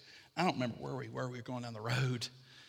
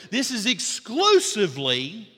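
EXCLUSIVELY drawn out, high and loud; emphatic preaching; 9 semitones higher, 10 dB louder, slower than usual. Real, not acted. Preacher to congregation on the key point, "exclusively" punched high and loud. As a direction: hammer "exclusively", stretched and forceful.

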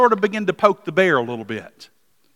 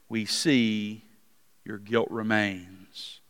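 measured, deliberate reading of the Gospel; scripture reading; 6 semitones lower than usual. Acted. Preacher reading John's gospel to the congregation, measured and solemn. Reads the Gospel passage aloud.

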